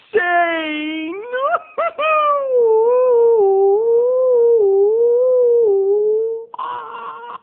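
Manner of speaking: long, high, loud mad cackle turning into a howl; crazed horror-host cackle, held on and on; high wailing villain laugh; maniacal laugh. Acted. Maniacal cackle after segment title.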